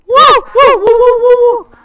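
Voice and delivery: high, loud rapid whoops; excited whooping. Real, not acted. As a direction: whoop in celebration. Celebrating placing well.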